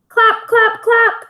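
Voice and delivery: high, loud clap clap clap; clapping sound words. Acted. Teacher voicing the claps in the story, high and loud. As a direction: pop out each clap.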